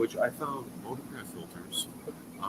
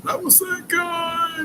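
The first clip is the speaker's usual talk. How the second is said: excited shout; 10 dB louder, much slower than usual. Real, not acted.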